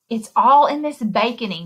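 plain reading voice

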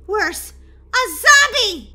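loud, high, slow panicked wail; frightened cry. Acted. Scared sister telling Daisy about the zombie, loud wail. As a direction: stretch it out in terror. Girl reports seeing a zombie.

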